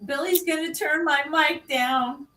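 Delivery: mocking quote. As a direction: mimic him complaining in a high whine. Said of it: "He said".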